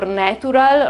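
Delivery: NATURAL said Italian-style as "neh-too-ral"; Italian mispronunciation. Shown as a demonstration. Teacher showing learners the Italian mistake, mispronounced. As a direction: say NATURAL with a hard T and "oo". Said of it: "in Italy we tend to say".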